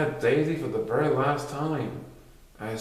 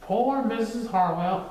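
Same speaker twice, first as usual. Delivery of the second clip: sad; 9 semitones higher, slower than usual. Acted. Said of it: "they all said sadly".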